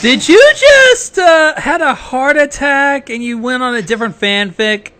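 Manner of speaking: high, slow, incredulous squeak; mock disbelief. Real, not acted. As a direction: act stunned at the reader's slip. Teasing the reader's slip.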